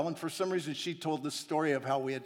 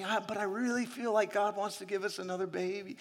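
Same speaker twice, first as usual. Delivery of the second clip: higher, softer wistful plea; wife's soft pleading voice, pitched up; imitating wife pleading; 5 semitones higher, 5 dB quieter than usual. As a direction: mimic the wife, hopeful and soft. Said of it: "She's like".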